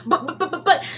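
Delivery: flustered repeated buts; Anansi sputtering. Acted. Anansi protesting about his jacket, high sputtering.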